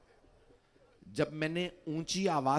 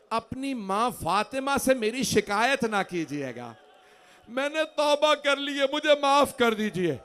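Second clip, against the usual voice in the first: pleading lament; 4 semitones higher, 5 dB louder than usual. Acted. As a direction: plead for forgiveness, voice raised. High pleading recitation, Hurr's apology. (بلند، پُرسوز التجا)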